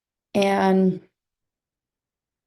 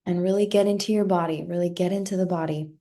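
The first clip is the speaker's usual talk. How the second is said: ordinary talk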